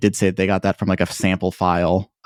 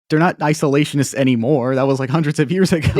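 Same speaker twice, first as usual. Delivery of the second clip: higher, incredulous mock protest; mock indignation; 7 semitones higher than usual. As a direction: argue back in exaggerated disbelief. Mockingly argues against naive defenders.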